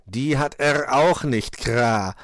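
slow, loud cawing mockery; amused raven. A narrator's reading. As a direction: gloat slowly, ending in a caw. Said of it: "lachte Meister Schwarzrock".

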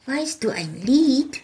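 high, bright little-folk voice; little folk asking. Acted. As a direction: ask like a tiny fairy creature. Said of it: "wurde ich gefragt".

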